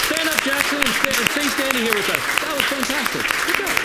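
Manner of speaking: loud, high host cheer; big congratulatory call; excited praise. Real, not acted. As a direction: hype up the volunteer for the crowd. Host cheers a volunteer onstage.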